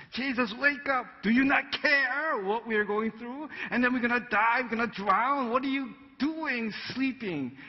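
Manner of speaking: high, frantic panicked cry; panicked disciples. Acted. Disciples in the storm waking Jesus, panicked high cry. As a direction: shout in fear over the storm.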